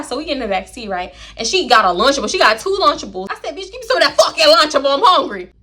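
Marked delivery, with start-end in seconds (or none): 3.6-5.5 playful demand. Retold or imitated. Demanding friend's Lunchable.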